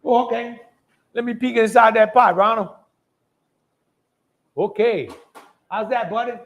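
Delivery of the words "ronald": ordinary talk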